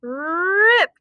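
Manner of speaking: ordinary delivery, nothing marked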